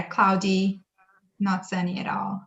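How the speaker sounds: ordinary delivery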